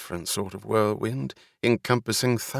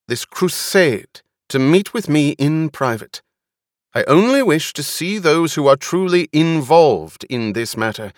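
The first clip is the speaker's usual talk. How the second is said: loud, higher-pitched public address; commanding speech pitched up over a crowd; raised voice; 5 semitones higher, 6 dB louder than usual. A narrator's reading. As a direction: address a crowd with stern authority. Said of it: "She raised her voice".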